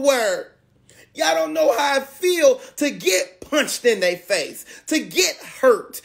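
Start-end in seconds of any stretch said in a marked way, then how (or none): none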